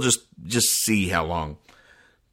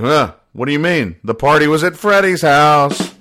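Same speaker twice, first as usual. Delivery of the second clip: groggy slacker; 6 semitones higher, 6 dB louder than usual. Retold or imitated. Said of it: "It's like".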